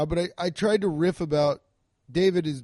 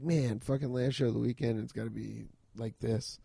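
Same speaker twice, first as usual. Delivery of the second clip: muttered grumble; 4 semitones lower, 7 dB quieter than usual. Retold or imitated. Comic's inner grumble.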